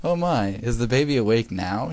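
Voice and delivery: ordinary delivery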